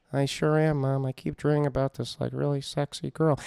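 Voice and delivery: sheepish reply to mom, hard to hear; subdued, mumbled confession in character; mumbling son. Acted. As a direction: imitate a downcast son owning up. Son answers mom, who can't hear.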